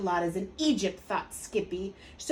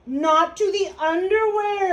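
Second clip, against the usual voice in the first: high, loud mock horror; exclaiming; 9 semitones higher, 8 dB louder than usual. A narrator's reading. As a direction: cry out in comic dismay. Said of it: "exclaimed Scapito".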